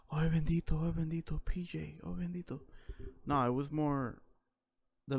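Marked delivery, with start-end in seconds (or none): 0.1-2.6 mock pitying Spanish lament, higher; imitating doting relatives' pity, whispered start; pitying relatives. Family pitying him: mock Puerto Rican lament. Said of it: "never in a form of support that was like".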